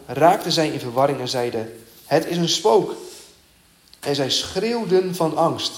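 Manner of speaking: a lifted reading voice that rises on the ghost cry; scripture reading. Acted. Reads the scripture passage aloud.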